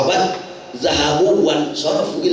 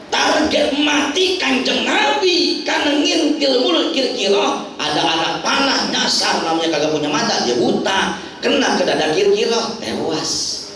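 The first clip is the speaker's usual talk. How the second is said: ordinary talk, nothing marked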